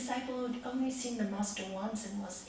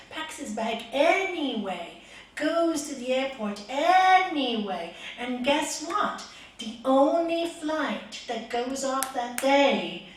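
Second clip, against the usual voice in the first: building excitement; 2 semitones higher, 8 dB louder, slower than usual. A narrator's reading. Building the story's climax.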